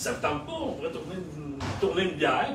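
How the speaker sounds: normal talking voice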